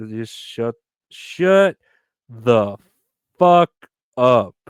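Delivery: spaced-out shouted shut the fuck up; word-by-word yell. Real, not acted.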